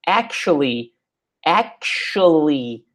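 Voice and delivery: actually said as AK-shly, slowly and clearly; slow model of actually; careful pronunciation. Shown as a demonstration. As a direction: spell out actually as akshly, drawn out. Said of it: "presta atenção na pronúncia dessa palavra".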